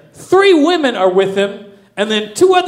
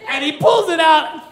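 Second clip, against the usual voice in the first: usual speaking voice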